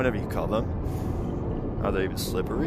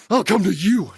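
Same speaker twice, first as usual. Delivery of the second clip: high, loud, frantic call; 9 semitones higher, 7 dB louder than usual. Real, not acted. Player to the jump-scare monster, loud calling out. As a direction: call out to the creature coming for you.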